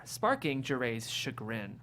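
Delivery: ordinary talk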